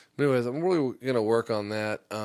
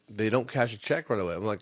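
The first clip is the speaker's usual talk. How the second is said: ordinary talk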